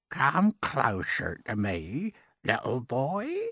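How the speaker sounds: put-on slow, creaky old man coaxing. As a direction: beckon the boy in, slowly. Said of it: "his voice was very slow and creaky".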